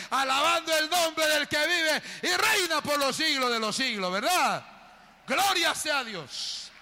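high, loud shouted preaching; preaching at full force. Acted. Pastor proclaims forgiveness to the congregation, high shouted preaching. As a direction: proclaim it over the whole church.

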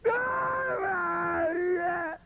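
high wailing "padre"; mock a crying shout to God; theatrical crying prayer. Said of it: "¿Por qué le hablan así al Señor?".

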